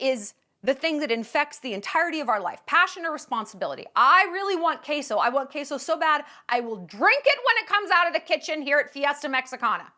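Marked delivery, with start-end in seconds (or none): 3.9-9.9 high, urgent mock craving; mock desperate craving. Comic voicing passion at a restaurant, overblown craving. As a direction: blurt it with overblown hunger.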